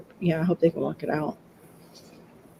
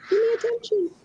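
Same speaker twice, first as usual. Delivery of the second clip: high little-dog voice; attention-seeking dog. Retold or imitated. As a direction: plead like a small, needy dog. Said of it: "he was barking at, like".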